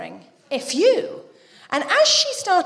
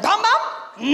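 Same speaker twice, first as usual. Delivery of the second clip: high imitation toddler with Spanish accent; mock Spanish-accented baby talk; toddler's Spanish accent; 7 semitones higher than usual. Toddler about to poke the cat, high Spanish-accented voice. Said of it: "she's got a Spanish accent".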